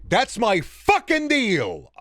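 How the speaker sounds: high, drawn-out angry emphasis; slow, high, exasperated anger; angry outburst. Real, not acted. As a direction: lay down the law, stretching the words. Angry rant, laying down terms.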